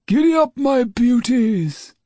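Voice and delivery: high, loud, slow sleigh call; jolly Father Christmas shout. A narrator's reading. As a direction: call out to the reindeer joyfully. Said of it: "he heard from outside".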